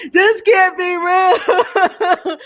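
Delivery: high, loud, slow laugh-crying disbelief; losing it, laughing so hard it cries; this can't be real, wheezing with laughter. Real, not acted. Breaking down laughing at clip.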